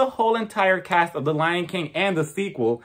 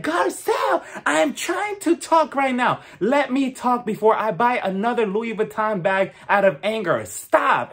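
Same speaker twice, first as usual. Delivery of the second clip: shouting; 5 semitones higher than usual. Retold or imitated. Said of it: "Dorit loses her damn mind".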